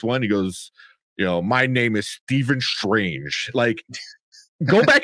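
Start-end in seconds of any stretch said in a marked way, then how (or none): 1.4-3.5 slow, drawn-out over-enunciation; over-enunciated British speech. Retold or imitated. Podcaster mimicking how British actors say things, over-enunciated. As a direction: imitate an over-careful British actor, very slowly. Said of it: "they over enunciate".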